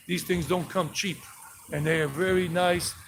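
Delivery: ordinary talk, nothing marked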